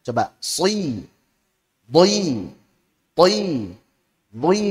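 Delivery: si, boi, toi said thick and rounded; letter drill. Shown as a demonstration. Teacher contrasting heavy letters for students. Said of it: "Beda kan kerasanya?".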